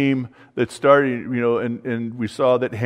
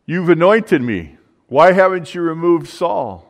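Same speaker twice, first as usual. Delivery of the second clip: crying out; 4 semitones higher, 5 dB louder than usual. Retold or imitated. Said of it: "David cried out".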